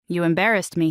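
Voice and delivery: plain reading voice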